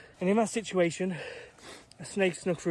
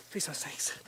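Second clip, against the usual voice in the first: frightened whispered prayer; whispered plea; 6 dB quieter than usual. Real, not acted. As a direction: beg under your breath. Alone on Snake Island praying.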